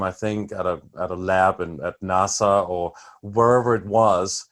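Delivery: ordinary delivery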